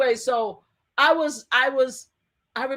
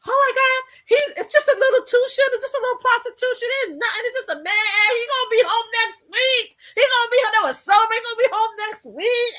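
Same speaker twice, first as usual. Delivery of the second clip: mocking supporters; 13 semitones higher, 3 dB louder than usual. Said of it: "they're like".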